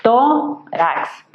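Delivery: "Tórax" in two beats, tó, rax, with a gap; syllable by syllable. Shown as a demonstration. Teacher dividing a word for students, "Tórax" syllable by syllable. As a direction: pull "Tórax" apart into tó... rax. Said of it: "obtenemos dos sílabas".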